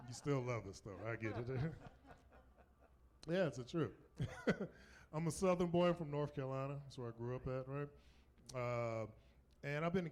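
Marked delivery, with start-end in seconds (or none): none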